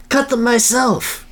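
hammed-up proud inventor; slow, high-pitched boast. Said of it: "Kintobor boasted".

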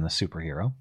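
ordinary talk, nothing marked

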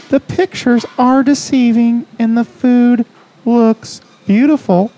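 very high-pitched comic reading voice; high, deliberate mock-reviewer voice; funny review voice. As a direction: read a complaint in a silly high voice. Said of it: "Bad Reviews Read in a Funny Voice".

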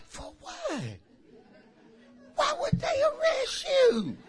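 high, mock-shocked squeal; disbelieving friends. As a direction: play the stunned friend in disbelief. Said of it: "they be like".

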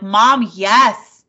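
loud, mock-exasperated kid answering mom. Kids to mom who keeps saying "I love you", exasperated. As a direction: imitate a kid fed up with being told. Kids fed up with mom's affection.

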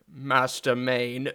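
slow, drawn-out mock British butler accent; mock Michael Caine accent. Joking about the Batman butler, drawn-out British accent. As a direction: stretch it out in a put-on British actor's accent. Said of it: "he was like".

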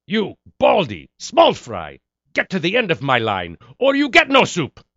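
put-on super-militant food server with Middle Eastern accent, loud and high; Soup Nazi. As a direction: shout orders at the queue like an ex-military man. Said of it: "super militant ex-military guy that's now serving food".

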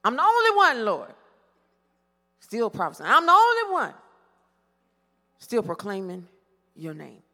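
Elijah's aggrieved whine, swelling loud on only; heartfelt complaining spiel. Retold or imitated. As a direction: plead your case to God, aggrieved. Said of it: "long, heartfelt spiel".

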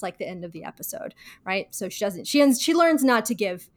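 usual speaking voice

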